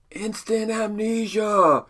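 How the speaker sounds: high, drawn-out concert-crowd holler; re-enacted shout. Fan interrupting [name]'s concert with a request, shouted out high. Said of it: "I just shouted out".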